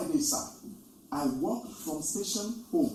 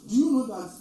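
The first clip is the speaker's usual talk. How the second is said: usual speaking voice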